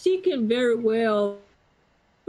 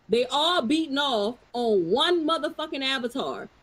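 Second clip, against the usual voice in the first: normal talking voice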